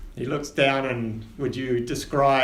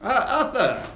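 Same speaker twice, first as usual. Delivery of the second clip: higher, grand ship captain; captain Winters; 3 semitones higher than usual. Acted. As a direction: welcome passengers aboard with flourish. Captain checks tickets.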